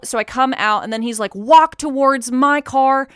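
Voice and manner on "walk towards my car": slow, loud, raised-pitch police orders; cop on loudspeaker. Retold or imitated. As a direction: imitate measured commands over a car speaker. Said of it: "With his, like, speaker thing".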